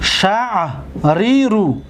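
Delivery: شعری رو held out very slowly; teacher drawing out شعری رو; slow demonstration. Shown as a demonstration. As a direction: stretch شعری رو syllable by syllable. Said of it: "اینجا دو تره بود".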